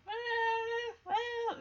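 high, soft little wail; distant child's cry. Retold or imitated. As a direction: imitate a small far-off cry, high and quiet. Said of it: "we hear this little".

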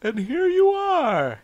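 very high, drawn-out mock gush; hammed-up father. Dad greeting long-abandoned daughter, hammed-up. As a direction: stretch it out melodramatically. Continues acting the gushing dad.

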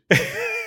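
a short quiet laugh at the memory; soft breathy laugh. Real, not acted. Laughing softly while telling the listener about the physio.

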